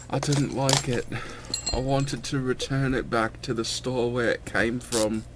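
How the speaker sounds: put-on a lightly done, appalling English accent; bad English accent. Soda reviewer jokes in a bad English accent. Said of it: "that appalling attempt at a, an English accent".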